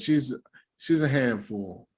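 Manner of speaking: ordinary delivery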